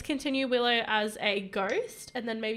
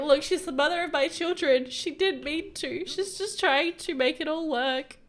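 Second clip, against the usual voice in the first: higher-pitched, insistent plea on her behalf; pleading husband; 4 semitones higher, 5 dB louder than usual. Acted. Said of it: "He's like".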